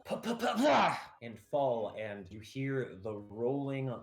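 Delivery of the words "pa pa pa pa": high sputtered "pa" sounds, getting louder; stumbling noise. Acted. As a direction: voice someone tripping and falling. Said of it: "you hear somebody stumble".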